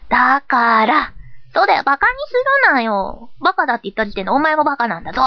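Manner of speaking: put-on indignant, childish comeback; hot-headed retort. Hot-headed persona retorting to mockery, indignant. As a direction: stretch the first word, then fire back.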